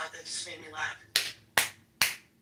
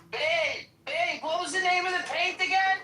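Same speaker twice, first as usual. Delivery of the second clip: higher, louder call to someone away; 11 semitones higher, 5 dB louder than usual. Real, not acted. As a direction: call out to babe across the house. Calling out to another room.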